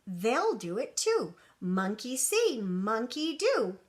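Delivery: ordinary delivery, nothing marked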